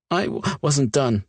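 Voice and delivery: hiccuping between tearful words. Acted. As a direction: catch a hiccup mid-word. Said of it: "she hiccuped".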